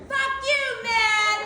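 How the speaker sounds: very high mock gadget voice; voice box prank. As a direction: blurt the insult high, like a toy box. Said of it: "I would press that speaker box".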